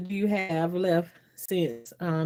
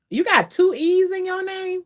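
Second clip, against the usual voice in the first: high-pitched, disbelieving mock question; incredulous question; 11 semitones higher, 4 dB louder than usual. People querying how she spells her name, incredulous. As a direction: act out someone baffled, pitched well up. Others react in surprise to spelling.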